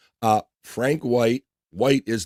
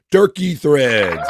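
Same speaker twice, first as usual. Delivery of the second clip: drawn-out, louder call of the name; 4 semitones higher, 6 dB louder, much slower than usual. Real, not acted. Host hails a viewer on the stream, drawn-out loud call. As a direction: stretch the name out like a big hello.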